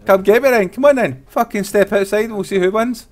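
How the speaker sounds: angry. Acted. Taunting police to fight.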